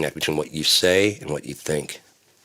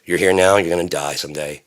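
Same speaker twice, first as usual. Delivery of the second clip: ordinary talk, nothing marked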